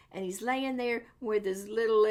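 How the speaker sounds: ordinary delivery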